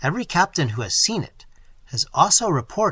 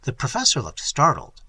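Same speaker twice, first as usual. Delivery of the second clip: usual speaking voice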